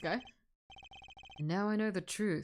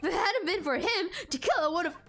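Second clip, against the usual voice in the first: blustering detective; 7 semitones higher than usual. Acted. Detective Gumshoe blusters in defense.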